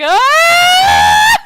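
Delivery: sudden high yelp of fright; falling-cart shriek; panicked scream. Acted. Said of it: "all of you are just like".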